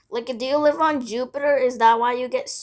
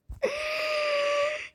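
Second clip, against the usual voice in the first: long sigh; 7 dB quieter than usual. Retold or imitated. Sigh after friend's promise.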